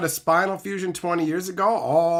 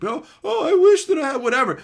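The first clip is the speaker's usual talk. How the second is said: high, fast, shouted mock-whine; mocking whiner; 13 semitones higher, 6 dB louder than usual. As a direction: parody an envious viewer. Mocking envious viewers.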